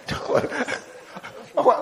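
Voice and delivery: breaking into laughter mid-sentence; can't hold back the giggles; laughing. Real, not acted. Preacher to congregation, laughing mid-line. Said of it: "why are you laughing?".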